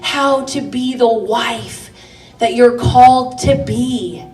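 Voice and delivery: rising, fervent sermon voice; preaching. Acted. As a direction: preach it with conviction. Preaching rises again.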